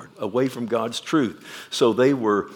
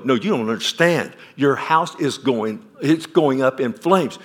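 urgent warning; 3 semitones higher, 6 dB louder than usual. Acted. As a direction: press the alarm harder each phrase. Neighbor insists house is burning.

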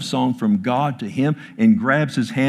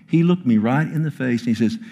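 normal talking voice

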